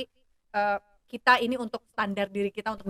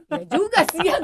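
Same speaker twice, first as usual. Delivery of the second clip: chuckling, higher; laughing agreement; 8 semitones higher, 5 dB louder than usual. Retold or imitated. Mother to daughter, laughing as she agrees. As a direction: laugh as you concede. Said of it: "gua bilang".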